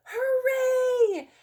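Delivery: a crowd celebrating; villagers cheering. Acted. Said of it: "villagers shouting".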